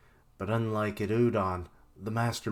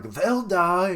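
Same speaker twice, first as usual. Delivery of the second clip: high, loud villain declaration; mad scientist; 9 semitones higher, 7 dB louder than usual. Retold or imitated. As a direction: announce it like a gleeful villain. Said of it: "Queen's like".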